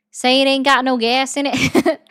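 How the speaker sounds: mock Southern hillbilly line; Southern accent. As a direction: play up a real country Southern accent. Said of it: "Southern accent".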